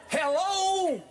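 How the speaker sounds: high, drawn-out jingle hello; playful high-pitched signature greeting. Shown as a demonstration. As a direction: sing out the hello like a catchphrase. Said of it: "I'm gonna give you the jingle".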